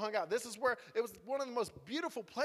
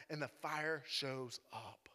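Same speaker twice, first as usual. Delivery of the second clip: slow, low near-whisper; hushed awe; 6 semitones lower, 6 dB quieter, much slower than usual. Real, not acted. Preacher revealing the miracle to listeners, hushed. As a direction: drop to a drawn-out hush.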